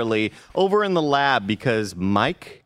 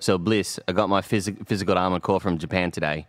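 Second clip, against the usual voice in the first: low-key Kiwi accent, a bit lower; New Zealand accent; 4 semitones lower than usual. Shown as a demonstration. Host showing where he learned it, Kiwi accent. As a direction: say it like a laid-back New Zealander. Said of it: "the reason why I know New Zealand".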